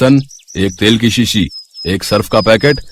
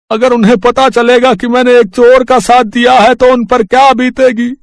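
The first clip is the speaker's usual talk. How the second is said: pleading confession; 13 semitones higher, 4 dB louder than usual. Acted. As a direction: beg forgiveness as a frightened poor man. Rickshaw driver confesses to inspector. (रिक्शे वाले की ऊँची, धीमी, गिड़गिड़ाती आवाज़)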